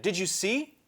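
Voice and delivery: high, eager asking; excited question. Acted. Edmund asking Peter, high excited question. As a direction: ask breathless with wonder.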